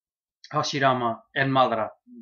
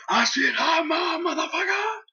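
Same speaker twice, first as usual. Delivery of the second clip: wildly excited. Acted. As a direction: squeal it out as a crazed, overjoyed rival. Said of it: "He's so excited".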